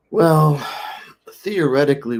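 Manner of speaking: a hint of a careful doctor easing into bad news; surgeon's voice. Surgeon voiced, notably higher.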